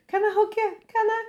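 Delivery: high, eager little plea for a hug; a hint of an adorable character begging for a hug; adorable sweet plea. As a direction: ask for the hug like a sweet, shy creature. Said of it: "He was adorable".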